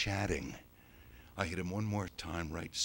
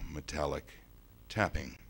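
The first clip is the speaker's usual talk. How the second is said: plain reading voice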